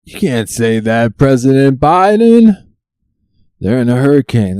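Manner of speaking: loud, high mock outrage; scolding outburst. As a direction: call him out, voice climbing high. Scolding the President after clip.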